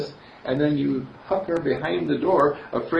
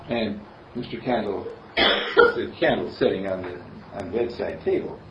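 ordinary talk, nothing marked

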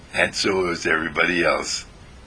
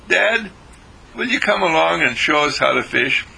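a son's higher, eager plea; sons asking; 7 semitones higher than usual. Acted. Sons begging their fisherman father to show them, high eager plea. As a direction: ask Dad like a hopeful boy.

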